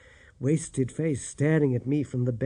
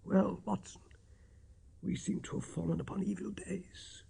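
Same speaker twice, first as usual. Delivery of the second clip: weak, croaking near-whisper; a dying man scraping out words; feeble voice; 6 semitones higher, 12 dB quieter than usual. A narrator's reading. Sick Holmes greeting his friend in a feeble whisper. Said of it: "said he in a feeble voice".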